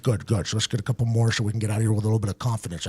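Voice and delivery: low, soft, quick coach mumble; imitating the coach. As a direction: mumble it fast, low and quiet. Acts coach encouraging at practice.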